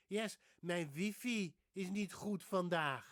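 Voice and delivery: slow, loud, high Dutch; exaggerated Dutch, "wifi" as "vee-fee"; Dutch speech. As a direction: play a Dutchman complaining in Dutch. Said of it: "they say Wi-Fi".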